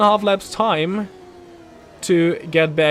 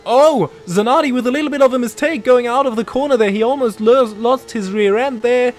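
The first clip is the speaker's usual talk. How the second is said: excited; 7 semitones higher, 5 dB louder than usual. Real, not acted. Mistake by rival driver.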